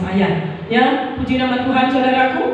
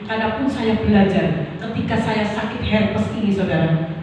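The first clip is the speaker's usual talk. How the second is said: ordinary talk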